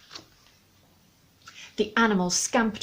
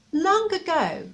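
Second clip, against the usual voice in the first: grand, lifted start to the tale; storybook opening; 8 semitones higher, 4 dB louder, slower than usual. A narrator's reading. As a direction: open the story like a fairy tale.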